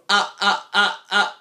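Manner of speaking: sharp, separate "ah" sounds with hard onsets; glottal stroke. Shown as a demonstration. Singing teacher demonstrates a glottal stroke, hard staccato "ah". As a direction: hit each "ah" from a closed throat. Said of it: "that's a glottal stroke".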